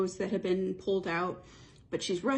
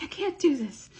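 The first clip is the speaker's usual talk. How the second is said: higher, louder despairing cry; overwhelmed despair; 8 semitones higher, 5 dB louder than usual. Retold or imitated. Heroine stands in the chaos and gives up, despairing cry. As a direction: break down, it's all too much.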